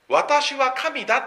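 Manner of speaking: high, loud, slow 私は神だ; 私は神だ spoken slowly and high; bold claim. Shown as a demonstration. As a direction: proclaim 私は神だ like a divine claim. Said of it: "という表現より強い".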